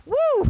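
very high-pitched "woo"; mock excited whoop. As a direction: let out a high cheer. Whooping at the organizer's idea.

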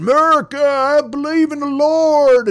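high, loud, slow mock zealot chant; mocking protester. Mocking a maskless protester with a sign, high hammed-up shout. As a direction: ham up a flag-waving protester, high and drawn out. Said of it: "these motherfuckers at these protests with these signs and going".

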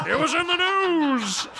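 high, strained comic croak; Neil Hamburger. Retold or imitated. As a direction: squeeze it out high like a failing stand-up. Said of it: "He's just doing Neil Hamburger".

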